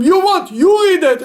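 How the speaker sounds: high, loud retort to the tempter; indignant outburst. Retold or imitated. Son-in-law rebuffing his evil inclination at the bima, loud. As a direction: shove it back at the Yetzer Hara.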